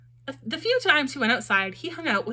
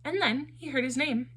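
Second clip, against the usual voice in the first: plain reading voice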